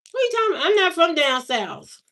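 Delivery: high-pitched, affronted pushback; indignant denial. Real, not acted. Speaker rebutting talk of a twang, indignant denial. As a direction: burst out disputing it.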